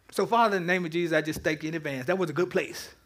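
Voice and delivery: quiet, reverent closing prayer. Acted. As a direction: pray it softly to the Father.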